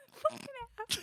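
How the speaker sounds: high, fast, crying with laughter; laughing. Real, not acted. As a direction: curse it through helpless laughter.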